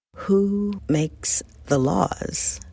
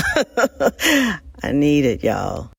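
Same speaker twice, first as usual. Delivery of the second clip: laughing; 4 dB louder, much slower than usual. Real, not acted. Laughing plea for summer break.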